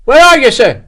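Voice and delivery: high, loud searching shout; calling back. A narrator's reading. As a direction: holler for your captain through the trees. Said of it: "called back through the trees".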